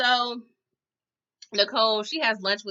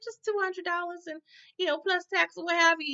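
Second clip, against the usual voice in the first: high mock voice; 9 semitones higher than usual. Voicing stripper's price.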